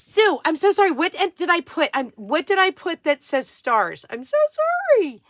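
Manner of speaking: high, loud, flustered; flustered apology. Real, not acted. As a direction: apologise in a rush of panic.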